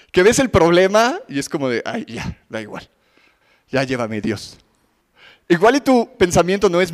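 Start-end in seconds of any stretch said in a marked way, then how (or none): 1.9-4.6 low, much softer, worn-out sigh of surrender; a mock despairing murmur to God; weary giving up. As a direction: let it sag like someone too tired to fight.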